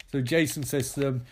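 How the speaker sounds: ordinary talk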